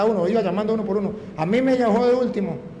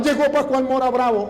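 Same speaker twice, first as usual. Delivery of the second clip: raised, higher mock shout of the students; retold shout; 6 semitones higher than usual. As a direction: call out the alarm the way the students did. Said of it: "Y todos gritaban".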